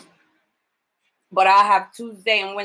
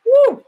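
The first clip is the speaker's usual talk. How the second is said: sudden high, loud whoop; excited whoop; 9 dB louder than usual. Real, not acted. Caregiver feeling the pill kick in, then a high loud whoop. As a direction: let out a wild woo as it hits. Pill kicks in while lying.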